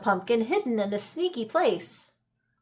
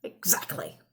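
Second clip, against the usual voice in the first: soft, unvoiced mutter; 11 dB quieter than usual. Old man mutters agreement to his wife, very soft. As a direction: mutter it under your breath. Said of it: "muttered the old man".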